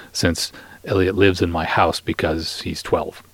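ordinary talk

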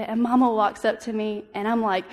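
ordinary delivery

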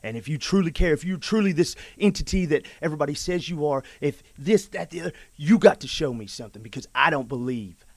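loud, challenging rant; calling God out. Retold or imitated. A man challenging God to show himself, loud and bitter. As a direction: demand proof, voice rising. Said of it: "calling him out".

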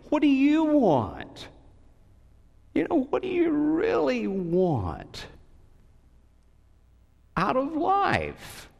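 high, pressing preacher's question; preaching. Acted. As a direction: drive the question home to the church. Preaches to congregation.

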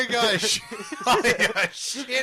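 put-on high, slow laughing Australian accent. Joking about the film in a laughing Australian accent. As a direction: draw it out Australian through laughter. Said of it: "Australian accent".

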